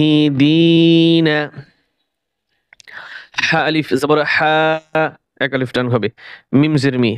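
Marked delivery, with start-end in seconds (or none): 0.0-1.4 slow drawn-out reading. Shown as a demonstration. Teacher to students, showing how to blend the syllables, slow chanted reading. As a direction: stretch every syllable, very slow and sung out. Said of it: "বানান করে পড়বেন".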